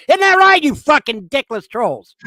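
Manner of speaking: yelling at his online trolls, real furious shout; screaming. Real, not acted. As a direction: scream the taunt at them. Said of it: "I hear you screaming your brains out".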